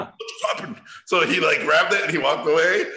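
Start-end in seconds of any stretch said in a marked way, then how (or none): 0.0-0.8 breathy, dazed disbelief; whispered shock from the surfer; stunned whisper. Retold or imitated. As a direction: whisper it like you've just been wiped out. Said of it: "He was like".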